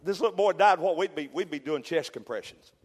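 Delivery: normal talking voice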